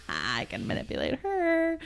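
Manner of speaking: high, soft sly whisper; hushed, smug teen smirk; smirking kid. Retold or imitated. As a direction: voice a kid smirking behind grandma's back. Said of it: "the little smirk".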